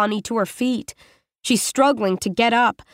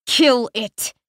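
pained, forced through teeth; through clenched teeth. Acted. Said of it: "forces her words through clenched teeth".

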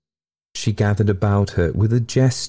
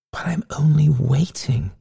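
whispery ghost reply; 8 semitones higher than usual. A narrator's reading. Ghost's hushed reply, partly whispered.

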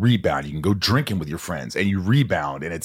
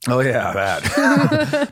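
laughing; 5 semitones higher, 3 dB louder, much slower than usual. Real, not acted. Remembers topic, laughing.

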